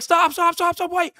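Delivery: high, rapid-fire plea to stop; high, loud panicked shout; urgent yell. Retold or imitated. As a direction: call out frantically to halt the door. Retells yelling at closing door.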